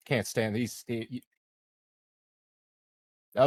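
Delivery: ordinary talk, nothing marked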